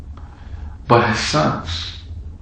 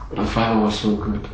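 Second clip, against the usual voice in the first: ordinary talk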